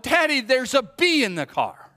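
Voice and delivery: high, loud imitation of a panicked girl; alarmed child. Daughter warning her dad about a bee, screamed. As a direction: cry it out to Daddy. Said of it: "she finally gets it out".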